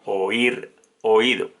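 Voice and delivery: ordinary talk, nothing marked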